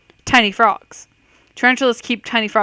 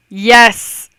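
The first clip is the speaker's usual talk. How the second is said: excited cheer; 9 dB louder than usual. Real, not acted. Quiz answer turns out true.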